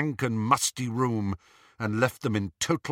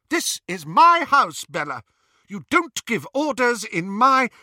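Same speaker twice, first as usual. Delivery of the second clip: high, loud objection; indignant protest; 12 semitones higher, 7 dB louder than usual. A narrator's reading. Narcissa objecting to sister's orders, indignant. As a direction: protest loudly, standing your ground.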